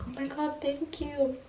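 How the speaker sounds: soft, high-pitched retold gratitude; touched thanks. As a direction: act out being moved by a compliment, small high voice. Her reply to compliment, acted.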